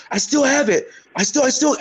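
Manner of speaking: high, pleading impression of an old fighter; a lightly voiced old fighter clinging on; mimicking Chuck Liddell. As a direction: play the aging champ insisting he's still got it. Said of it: "'cause he goes".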